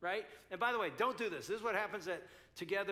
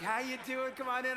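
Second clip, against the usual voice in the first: higher, overly cheerful greeter; cheery fake greeter; 6 semitones higher, 4 dB louder than usual. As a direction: welcome guests with forced cheer. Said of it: "Dave's never done that".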